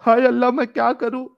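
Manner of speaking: high-pitched mock wail; mock lament. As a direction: play helpless despair in a high voice. Mock lament after reading the hadith. (ऊँचे सुर में बनावटी हाय-हाय)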